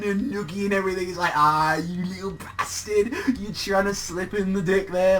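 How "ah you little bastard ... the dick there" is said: high, drawn-out mock scolding; hammed-up teasing in a raised voice; playful ribbing. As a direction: tease him loudly like a proud, rowdy mentor. Acted master teasing pupil during noogie.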